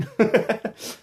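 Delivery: a quick high laugh at himself; embarrassed little laugh; self-mocking giggle. Real, not acted. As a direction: laugh off the slip. Laughs at his own joke.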